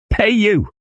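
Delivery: high-pitched outraged exclamation; indignant cry. A narrator's reading. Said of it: "he cried".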